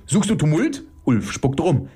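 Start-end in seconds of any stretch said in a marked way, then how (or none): 0.1-0.8 high, challenging shout; calling out. Acted. Ulf challenging his opponent to a row, calling out. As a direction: call out a high-pitched challenge. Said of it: "ruft Ulf".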